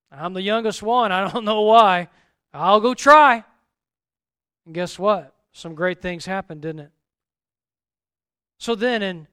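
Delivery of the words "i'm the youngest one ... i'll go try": from a mild start to a loud, eager vow; Gideon voiced, building. Gideon accepting God's call, rising to a loud resolve. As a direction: swell from humble to fired-up.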